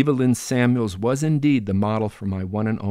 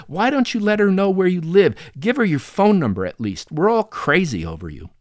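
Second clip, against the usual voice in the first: exasperated pleading; 9 semitones higher, 5 dB louder than usual. Acted. Brother pleading with sister.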